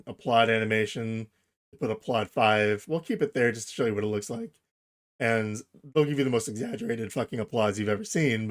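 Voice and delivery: normal talking voice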